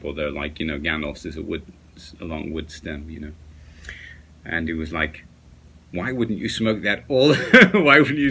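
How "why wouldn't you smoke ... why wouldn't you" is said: quoting a friend, breaking into laughter; higher, louder mock question cracking up midway; amused imitation. Vlogger retelling a friend's pipe question, laughing imitation.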